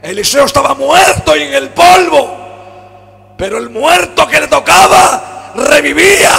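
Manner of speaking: loud, high, impassioned sermon delivery; fervent Pentecostal preacher in full cry; full-force preaching. Acted. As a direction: preach it out, voice climbing. Preaching with rising fervour.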